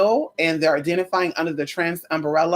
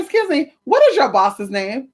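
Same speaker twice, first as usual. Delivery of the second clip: high, overly polite "excuse me"; prim demand; 7 semitones higher than usual. Retold or imitated. Demanding the boss's name at the counter, primly polite. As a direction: put on a prim customer tone. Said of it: "And I said".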